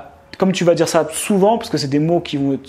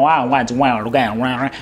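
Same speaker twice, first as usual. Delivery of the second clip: mock American accent; 4 semitones lower, much slower than usual. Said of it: "c'est pas genre".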